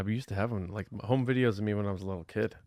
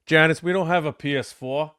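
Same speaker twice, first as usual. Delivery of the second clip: higher, louder New York dad voice; dad's New York voice; 6 semitones higher, 5 dB louder than usual. Retold or imitated. Son retells dad's puzzled complaint to mom in New York accent. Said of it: "and he goes".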